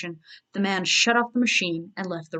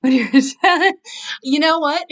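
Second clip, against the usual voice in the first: laughing; 8 semitones higher, 8 dB louder, much slower than usual. Real, not acted. Reader cracks up at herself.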